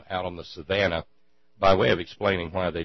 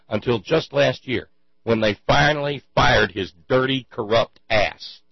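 indignant rant; 5 semitones higher, 9 dB louder than usual. Real, not acted. Ranting to listeners about a corrupt cop, loud and furious. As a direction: let the outrage swell until you're nearly yelling.

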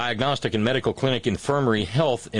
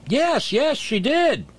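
high-pitched, emphatic owning-up; defiant; 11 semitones higher, slower than usual. Real, not acted. Host answering an imagined critical headline, defiant.

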